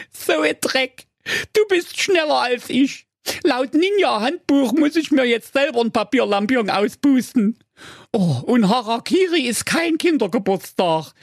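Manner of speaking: high, anxious cartoon ninja; comic ninja voice. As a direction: mutter worried thoughts, high pitched. Defeated ninja laments.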